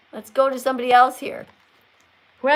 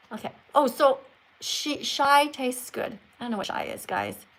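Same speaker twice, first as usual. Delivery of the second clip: ordinary talk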